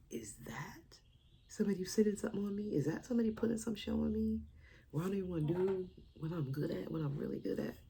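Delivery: high-pitched, quickening self-questioning; incredulous questioning. Retold or imitated. Acts out own suspicious inner questioning.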